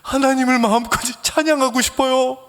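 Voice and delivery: high-pitched, put-on tearful plea; mock wailing. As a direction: imitate a drunk caller wailing. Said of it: "엉엉 우는 거예요".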